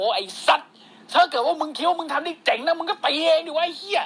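high mock-angry challenge. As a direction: imitate a riled golfer snapping back. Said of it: "ลาไนท์แม่งโมโห".

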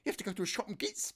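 ordinary talk, nothing marked

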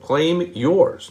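normal talking voice

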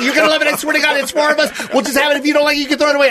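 high, urgent mock coaxing; insistent friend. Imitating his friend pushing extra food, high insistence. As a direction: oversell it in a high voice. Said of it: "he's doing that whole thing".

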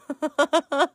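short high laugh. Real, not acted. Laughing about the failed sorcerer. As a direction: burst out laughing.

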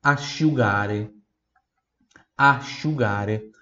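asciugare said slowly, a-shu-GA-re; slow Italian teacher model. Shown as a demonstration. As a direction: stretch asciugare for repeating. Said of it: "A R E de escola".

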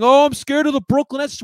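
loud, high mock whine; mocking scared fan. Mimicking timid fans for his viewers, loud mocking whine. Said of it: "Stop saying".

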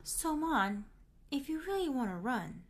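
lightly acted high, coaxing voice. Chandler coaxing Monica to give up.

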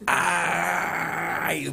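long held "aaay" of pain; drawn-out cry of pain. Acted. Preacher acts out a man snagged on a door, drawn-out yelp.

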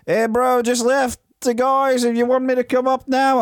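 a hint of a casual mate's drawl; mock Shane.

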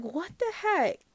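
high, shocked exclamation; startled disbelief at the door; mock shocked gasp of words; what the heck.

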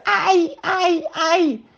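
a tiny otter yelping in pain; pained cry. Acted. Baby otter crying out as the rock hits her tail, high squeals. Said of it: "la nutria bebé gritó".

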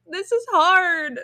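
whiny. Retold or imitated. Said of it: "be like".

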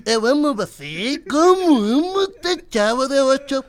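put-on Spanish-accented Kiko voice; Kiko impression. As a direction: play Kiko mangling Portuguese. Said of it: "o Kiko".

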